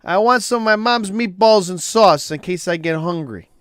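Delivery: loud, high mock Italian accent; Italian guy voice in the joke. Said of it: "So the Italian guy, of course, goes".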